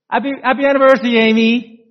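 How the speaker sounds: very fast, high, gushing happy anniversary; excited greeting. Retold or imitated. Husband greeting his wife at the door, giddy. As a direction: rush it out with eager joy. Sam's second greeting, rushed.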